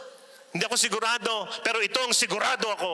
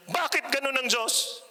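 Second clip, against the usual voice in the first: angry woman; 10 semitones higher than usual. Retold or imitated. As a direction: voice a woman furious with God, pitched higher. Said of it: "galit na galit siya". (galit na boses ng babaeng nagrereklamo sa Diyos)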